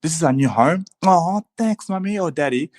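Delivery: put-on high-pitched delighted child; excited kid. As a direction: imitate a grateful kid thanking parents. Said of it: "smile of a kid's face when they're like".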